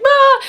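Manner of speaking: high, loud hammed-up shriek; mock scream. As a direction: yelp as if startled. Getting on the exam table.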